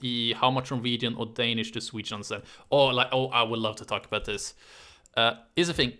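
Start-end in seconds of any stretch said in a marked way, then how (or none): none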